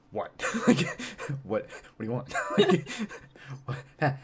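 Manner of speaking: irritated, laughing through it; annoyed what. Retold or imitated. Actor disturbed in his headphones, snapping what with a laugh. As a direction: brush off the intruder, half-amused. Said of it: "and I'd just be like".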